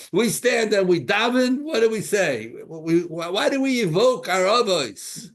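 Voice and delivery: loud, high, insistent preaching; impassioned preaching. Real, not acted. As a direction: drive the teaching home with force.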